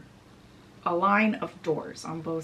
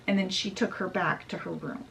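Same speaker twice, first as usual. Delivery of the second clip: usual speaking voice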